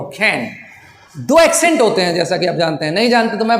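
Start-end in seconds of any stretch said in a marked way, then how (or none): 0.0-0.5 कैन said like can, with a short open vowel; कैन as can, not keen; English accent sound. Shown as a demonstration. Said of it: "जैसे वो कैन बोल रहा हो".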